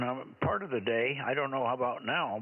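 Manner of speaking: ordinary delivery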